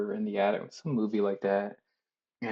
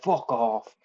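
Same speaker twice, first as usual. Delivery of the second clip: mock fuck off; 5 dB louder than usual. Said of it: "He's like".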